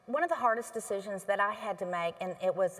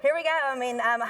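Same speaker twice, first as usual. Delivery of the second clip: normal talking voice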